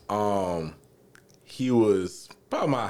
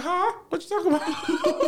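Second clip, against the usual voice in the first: much higher, playing dumb in a fake voice; his fake voice; 3 dB louder than usual. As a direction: squeak it out innocently, mocking him. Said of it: "I exposed him for the fake voice".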